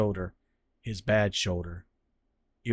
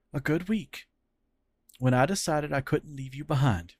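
higher, hushed heavy admission; Fran's grave confession; 5 semitones higher than usual. Acted. Fran revealing his terminal cancer to Cyril, grave. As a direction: confess the illness gently.

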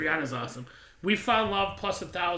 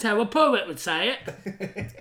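said through a laugh, slowly; amused, slower and louder; 4 semitones higher, 6 dB louder, slower than usual. Real, not acted. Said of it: "That's your French accent".

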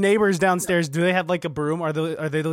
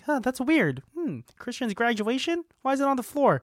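higher-pitched mock puzzlement; zdziwiony domownik; 8 semitones higher than usual. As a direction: puzzle aloud like a baffled relative, pitched up. Acting out puzzled family finding pictures.

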